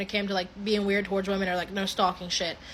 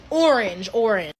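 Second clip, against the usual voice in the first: "orange, orange" punched louder for emphasis; emphatic orange; 4 dB louder than usual. Shown as a demonstration. Describing his eyes to the listener, "orange" stressed loud. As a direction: lean hard on each "orange". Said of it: "like, this orange".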